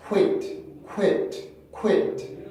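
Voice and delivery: "quit" as ku-IT, gliding through w to a released t; English sequential pronunciation. Shown as a demonstration. Teacher models the difference for students, glided syllable with final t. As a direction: push the stress of "quit" onto the vowel, finish on a crisp t. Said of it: "자, 소리가 다르죠?".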